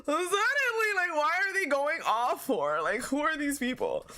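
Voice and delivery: high-pitched, amused disbelief; incredulous outburst. Real, not acted. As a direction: exclaim as if utterly caught off guard. Reacts to sudden voice acting in game.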